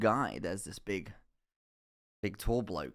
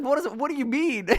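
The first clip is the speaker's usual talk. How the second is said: shocked; 5 dB louder than usual. Retold or imitated. Student reacting to the lecturer knowing him, shocked. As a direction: squeal it in total disbelief.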